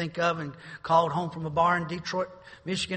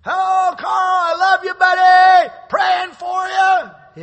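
high, loud calling out, last words very slow; cheerful shouted greeting; 17 dB louder, much slower than usual. Retold or imitated. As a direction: hail him across the yard with joy. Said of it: "I can hear his voice".

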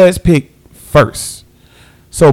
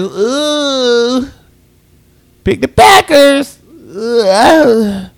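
pained ooh; 7 semitones higher, much slower than usual. Real, not acted. Reacting to Packers pick.

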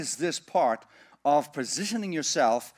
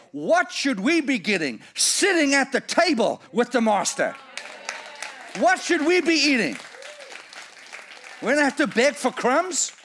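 higher and louder with each question; rising preaching climax; 9 semitones higher, 6 dB louder than usual. Acted. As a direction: build to a triumphant pulpit shout. Sermon climax on the master's table.

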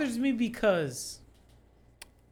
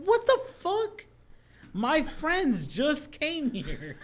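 high, incredulous outcry, then slowing down; shocked outburst; 9 semitones higher, slower than usual. Retold or imitated. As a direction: blurt it out in disbelief. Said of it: "I was like".

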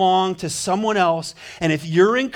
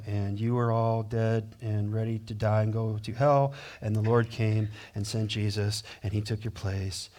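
quiet, flat mock whisper; 6 semitones lower, 8 dB quieter than usual. Shown as a demonstration. Preacher mocking a timid gospel whisper. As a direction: mumble the good news half-hearted. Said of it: "How are you gonna whisper that?".

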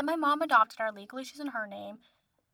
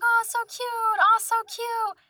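high-pitched mock cooing; cooing crowd; 11 semitones higher, slower than usual. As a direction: imitate people gushing over puppies, drawn out at the end. Imitating crowd cooing over dogs.

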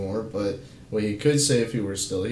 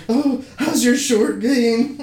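high, slow eager Meeseeks voice; chirpy cartoon helper voice; Meeseeks imitation; 11 semitones higher, 5 dB louder, slower than usual. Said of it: "she's like".

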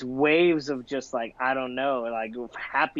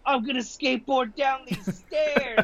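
a higher-pitched mock boast; drunk guy's boast; 10 semitones higher than usual. Drunk skit guy announcing his stair stunt, high mock bravado.

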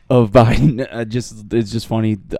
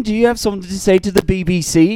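mock British reporter; 7 semitones higher than usual. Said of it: "I can't do a British accent".

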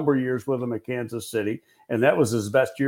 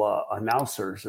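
ordinary delivery

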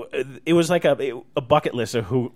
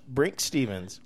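ordinary talk, nothing marked

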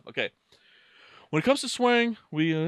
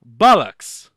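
very loud English-accented curse; punched British exclamation; 12 dB louder than usual. Shown as a demonstration. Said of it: "you have to do it with the accent".

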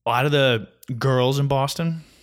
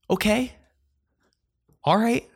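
sarcastic okay; 11 semitones higher than usual. Real, not acted. Annoyed reaction to the girl.

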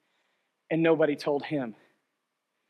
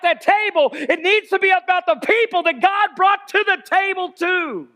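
raised, high-pitched exhortation; impassioned preaching; 9 semitones higher, 4 dB louder than usual. Real, not acted. Preacher urging church to claim lives for Jesus, impassioned preaching. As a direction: drive each phrase home like a rallying call.

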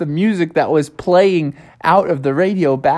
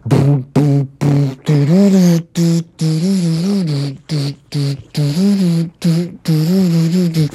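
vocal beatboxed synth pulse; electronic dance beat. Retold or imitated. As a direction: imitate a club beat with your mouth. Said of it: "doing some electronic dance beats".

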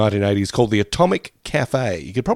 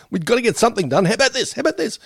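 panicked; 11 semitones higher, faster than usual. Retold or imitated. Panicked writers under deadline.

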